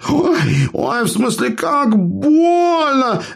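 high, slow hammed-up wailing; fake pain. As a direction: ham up the sore ear. Dwarf fakes ear pain.

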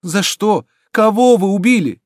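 high, urgent questioning; climbing cry of horror; horrified. A narrator's reading. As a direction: demand it loudly, aghast. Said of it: "с ужасом повторяла молодая девушка".

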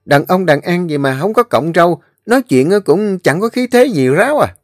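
high, quick amazed chatter; finally it all makes sense to him; excited gossip. Acted. (giọng chú Tư cao, hào hứng bàn tán)

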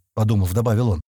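ordinary delivery

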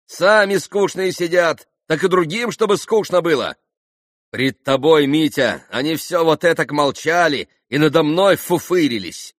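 high, loud grumbling tirade; vexed. A narrator's reading. Grushenka complaining to Mitya about the dull guests, vexed. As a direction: complain crossly at full voice. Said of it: "в досаде Грушенька".

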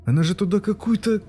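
put-on high child's voice, upset and insistent; a small boy making his case; indignant boy. Boy protests to mother, high and indignant. Boy's voice in story.

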